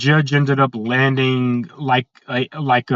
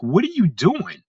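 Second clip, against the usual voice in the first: mock-scolding judge, higher pitch; retold scolding; 5 semitones higher than usual. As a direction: scold in a retold, incredulous question. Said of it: "the judge scolded her".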